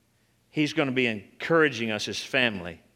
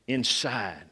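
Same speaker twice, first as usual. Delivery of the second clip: ordinary talk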